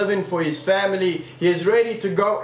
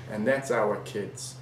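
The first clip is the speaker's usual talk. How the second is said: normal talking voice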